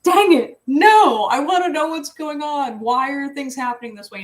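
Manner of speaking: high, loud whining protest; frustrated whine. Retold or imitated. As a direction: whine like a kid denied an answer. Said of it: "And I was just like".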